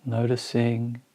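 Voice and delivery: slow, spacious meditation guidance with long pauses; guided meditation. Acted. Teacher guiding listeners through a body scan, slow meditation voice. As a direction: ease each phrase out slowly, letting it settle.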